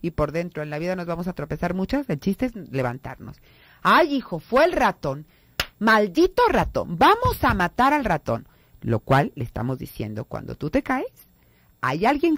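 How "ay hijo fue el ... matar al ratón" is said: higher, louder mock outrage at the mouse; fussing mother. Mother consoling her fallen son by blaming a mouse, hammed-up. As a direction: blame the mouse to distract the child. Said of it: "se cae el niño".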